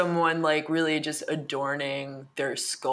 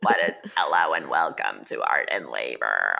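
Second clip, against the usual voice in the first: deep, creaky Miss Cleo fry; vocal fry. Pretend. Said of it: "Ultimate vocal fry".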